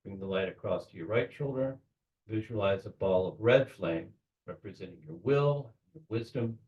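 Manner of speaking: hushed, unhurried meditation-leader delivery; guided meditation. Real, not acted. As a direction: guide them quietly, phrase by phrase. Leads a guided visualization.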